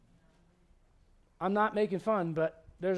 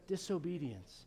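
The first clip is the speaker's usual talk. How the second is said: normal talking voice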